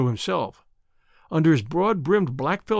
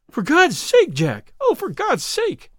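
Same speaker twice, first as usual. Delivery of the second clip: high, loud, desperate plea; distracted cry; 10 semitones higher, 4 dB louder, slower than usual. A narrator's reading. Woman begging her sweetheart not to fight, distracted cry. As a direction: cry out frantically, pitch leaping up. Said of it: "cried poor distracted Eddie".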